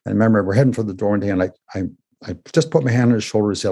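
usual speaking voice

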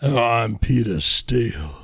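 deep, slow mock [name]. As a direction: drop the voice low and drag it out like [name]. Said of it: "that is the wrong voice".

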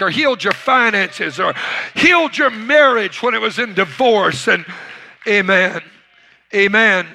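loud, high call to the room; preaching call. Acted. Pastor calling his church to testify, loud and high. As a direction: rouse the congregation to answer. Calls to congregation.

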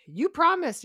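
high, loud, accusing retold quote; mock protest. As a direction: imitate an indignant complaint. Mimics a character's hurt protest.

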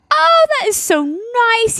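high, slow sugary reply; sing-song mock gratitude; mock sweet gush. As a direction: gush with fake delight. Wife reacts sweetly to gift news.

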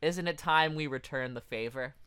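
ordinary talk, nothing marked

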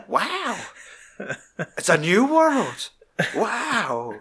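high, drawn-out mock wonder; gobsmacked. Film dad hearing of modern girls, gobsmacked. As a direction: imitate an amazed dad marvelling slowly. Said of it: "He was literally gobsmacked".

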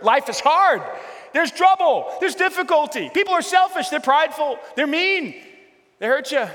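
high, loud declaring, peaking on prideful; fervent preacher at full force; preaching shout. Real, not acted. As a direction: hammer each short truth home. Preacher hammering hard truth.

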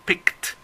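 "picked" said with a final t, no extra syllable; teacher's model word. Shown as a demonstration. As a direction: model "picked" ending in a crisp t. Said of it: "se pronunció como t".

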